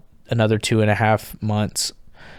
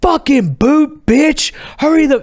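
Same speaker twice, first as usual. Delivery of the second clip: high, loud drill-style yell at the new arrival; retold screaming; 5 dB louder, slower than usual. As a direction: imitate hazing screams, high and drawn out. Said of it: "screaming at us".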